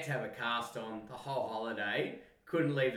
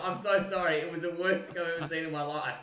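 ordinary talk

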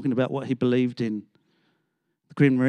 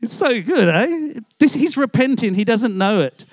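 usual speaking voice

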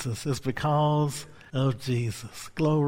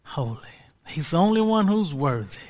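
ordinary talk, nothing marked

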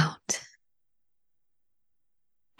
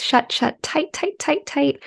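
strained tense voice; 6 semitones higher, 3 dB quieter than usual. Acted. Tensing face muscles while counting.

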